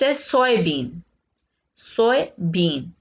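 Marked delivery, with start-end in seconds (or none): none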